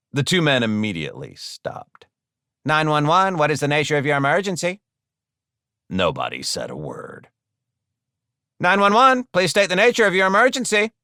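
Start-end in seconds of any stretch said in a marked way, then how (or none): 2.6-4.8 brisk, higher-pitched dispatcher voice; quick, official phone greeting; emergency operator. Acted. 8.6-10.9 fast, high-pitched dispatcher voice; firmer, raised operator voice; insistent emergency operator. Acted. Operator repeats question into the silence.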